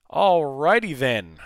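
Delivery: slow, loud, stretched alrighty then; drawn-out catchphrase. Retold or imitated. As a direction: ham up the goofy catchphrase.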